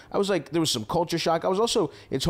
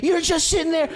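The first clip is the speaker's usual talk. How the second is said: put-on high shrill little-girl scold, much higher and louder; imitating girl yelling; 4 dB louder than usual. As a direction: yell it like a bossy little girl playing house. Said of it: "she starts yelling at me".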